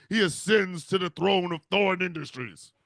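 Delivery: ordinary talk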